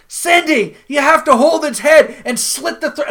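loud, high mock holler; hammed-up shouted instructions; imitated yelling. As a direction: yell it out like calling instructions across a table. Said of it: "So then he yells out".

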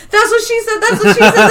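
high, loud repeated catchphrase; hammed-up blurting, voice up high; mock Pam blurting. Pam on the Bluetooth in the corporate office, high and loud.

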